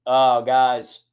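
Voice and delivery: usual speaking voice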